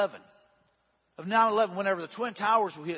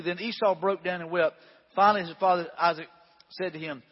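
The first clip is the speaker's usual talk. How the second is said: ordinary delivery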